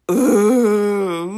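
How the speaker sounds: pained laugh that sounds like crying; whimpering laugh. Real, not acted. Remembering bleeding through her shorts, whimpering laugh.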